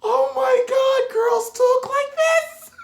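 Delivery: very high, slow falsetto gasp; high mock-shocked squeal. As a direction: act scandalised in falsetto. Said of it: "And I was like".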